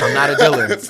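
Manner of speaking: loud, high protest breaking into laughter; laughing denial. Retold or imitated. Repeating his denial to the host, loud and laughing. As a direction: insist it while cracking up.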